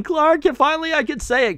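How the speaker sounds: high-pitched mock yell; mock excited yell. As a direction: squeak it out like a thrilled fanboy. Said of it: "Booster Gold yelling".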